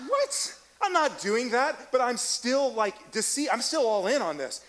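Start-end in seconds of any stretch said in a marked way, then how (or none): 0.1-1.9 high-pitched disbelieving "What?"; stunned, much higher squeak of disbelief; shocked outburst. Retold or imitated. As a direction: retell the price shock with a jump up in pitch. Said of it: "And I go".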